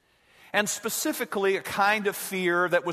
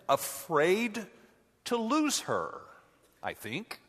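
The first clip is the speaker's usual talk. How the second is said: a hint of Yoda; 4 semitones higher, 3 dB quieter, slower than usual. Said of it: "Yoda chimes in".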